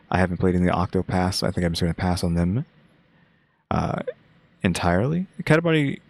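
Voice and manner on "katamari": Katamari called out high and bright; excited. Real, not acted. Repeats game name excitedly.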